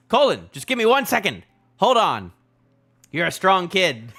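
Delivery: loud, high acted rallying call; urgent calling out. Rescuer asking Colin to hold on, loud urgent call. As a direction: urge a kid to hang on.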